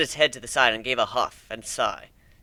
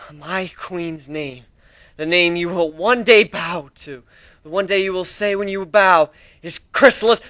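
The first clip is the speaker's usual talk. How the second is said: reverent zealot; 4 semitones higher, 6 dB louder than usual. Acted. Drone speaks reverently of queen.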